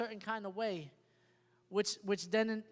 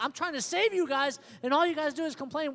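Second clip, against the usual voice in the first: imitating a fed-up Moses, higher and louder; frustrated; 5 semitones higher, 4 dB louder than usual. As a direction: complain in exasperation at the people, acted. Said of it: "he got frustrated at the people".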